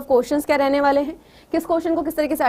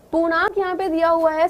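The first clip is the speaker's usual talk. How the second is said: normal talking voice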